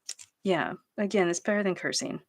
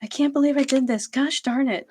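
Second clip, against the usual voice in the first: higher, louder and quick exasperation; fast frustrated self-scolding; 7 semitones higher, 3 dB louder than usual. Retold or imitated. Scolding herself over the burnt cornbread, fast and higher. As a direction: rush it out, annoyed at yourself. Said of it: "that's how I was cursing at myself".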